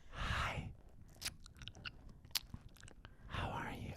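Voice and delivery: soft breathy ASMR whisper. Retold or imitated. Imitating an ASMR girl greeting viewers in a whisper. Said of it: "the girl with the lip".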